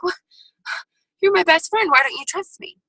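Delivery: sigh, then a high hurt plea; hurt disbelief. Retold or imitated. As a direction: sound wounded that your best friend won't back you. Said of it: "And you're like".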